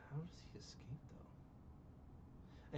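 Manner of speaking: whispered question to himself; whispered aside. Real, not acted. Puzzling over the plot hole to himself, whispered. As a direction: drop to a whisper.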